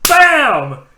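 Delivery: loud, high crash word; shouted impact. Retold or imitated. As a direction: belt it out like a sudden collision. Imitates the crash in story.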